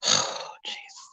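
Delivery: sighing, whispered appeal to Jesus; stunned whisper. Real, not acted. Stunned by the list of his children, whispering. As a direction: breathe it out, unvoiced.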